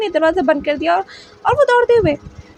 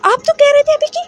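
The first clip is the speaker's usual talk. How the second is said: a son shouting that his parents are bewitched; angry shocked accusation; 8 semitones higher than usual. Acted. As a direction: voice pitched up in furious disbelief. Wing shocked, accusing alpha loudly.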